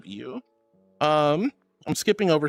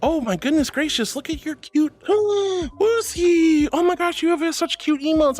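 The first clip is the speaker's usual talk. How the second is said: higher, gushing over cute emotes; 8 semitones higher than usual. Real, not acted. Streamer gushing over a viewer's cute emotes, higher. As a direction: coo and squeal over something adorable.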